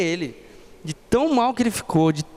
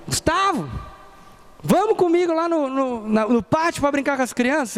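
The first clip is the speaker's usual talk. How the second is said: put-on high eager kid's voice, pitched way up; child voice; 7 semitones higher than usual. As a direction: play the excited little boy begging to go. Kid friend calling Gustavo.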